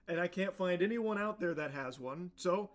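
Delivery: ordinary delivery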